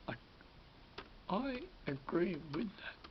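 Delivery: normal talking voice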